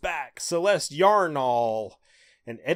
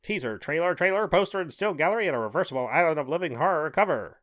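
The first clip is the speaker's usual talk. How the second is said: put-on old-timey voice; 3 semitones higher than usual. Shown as a demonstration. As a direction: rattle it off like an old newsreel. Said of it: "I'm gonna read the rest of it in, uh, old-timey voice".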